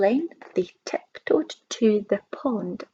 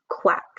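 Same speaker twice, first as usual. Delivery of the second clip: breathy voiceless quack; whispered quack. Acted. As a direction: quack with no voice at all. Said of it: "said the duck".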